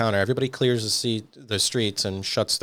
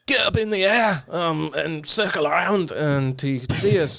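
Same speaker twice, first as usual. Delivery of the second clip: put-on Scottish accent; 8 semitones higher than usual. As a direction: bark orders in a Scottish accent. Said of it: "You sound like the Scottish guy from Samurai Jack".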